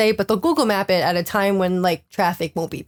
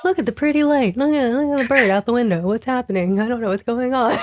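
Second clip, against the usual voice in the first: put-on lilting, childlike daydreamer; singsong mock innocence; oblivious passenger; 2 semitones higher than usual. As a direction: play someone happily clueless in the back seat. Imitates oblivious man in the back.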